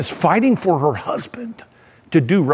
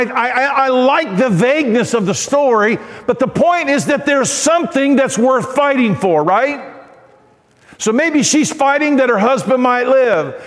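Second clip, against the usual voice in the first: raised, high-pitched sermon delivery; impassioned preaching; 5 semitones higher than usual. Real, not acted. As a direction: preach it with rising fervour.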